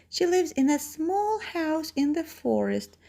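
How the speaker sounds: put-on high-pitched little girl voice.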